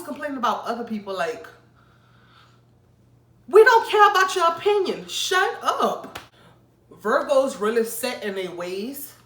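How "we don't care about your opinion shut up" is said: much higher and louder, fed up; exasperated shout. Acted. Telling Virgos to stop talking, a loud shout. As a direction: yell it at them, done listening.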